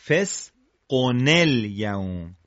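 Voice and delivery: slow فسقن الیوم as "fesqo-NEL-yawm"; joined tanween. Shown as a demonstration. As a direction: break out the linking "nel".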